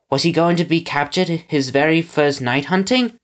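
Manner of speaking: ordinary delivery, nothing marked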